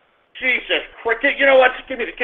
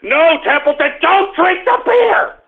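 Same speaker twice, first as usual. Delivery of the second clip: high, slow yell at the dog; 8 semitones higher, slower than usual. Real, not acted. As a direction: stop the dog with a drawn-out high cry. Yelling at dog drinking beer.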